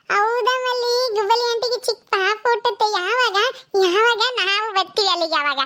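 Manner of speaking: put-on high, eager little girl asking questions; child voice.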